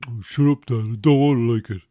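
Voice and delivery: put-on grumbling teen brushing off his father; sulky teenage son. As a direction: play the moody teen who's fed up with his dad. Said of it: "because then they'll go".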